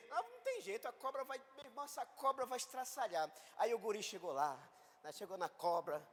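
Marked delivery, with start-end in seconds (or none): 0.1-3.3 high, frantic certainty of doom; panicked despair. Retold or imitated. Tourists watching the boy near the snake, high and panicked. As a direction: cry out that it's hopeless.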